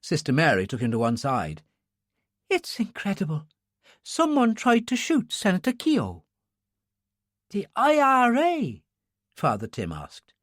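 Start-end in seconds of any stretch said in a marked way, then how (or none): 2.5-6.2 high, hushed disbelief; shocked nun. Acted. Sister Mary tells the priest of the shooting, shocked. 7.5-8.8 very high, slow question; shocked priest. Acted. Father Tim hears of the shooting, shocked.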